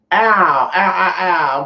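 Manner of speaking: pained ow. Real, not acted. Sudden real pain.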